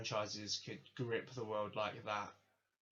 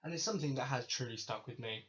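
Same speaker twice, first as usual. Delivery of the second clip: ordinary talk, nothing marked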